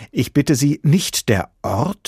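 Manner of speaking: zealot's scandalized question; wide-eyed disbelief. A narrator's reading. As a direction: ask "nicht der Ort?" slowly, astounded, rising at the end. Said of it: "fragte der Prüfling mit weit aufgerissenen Augen".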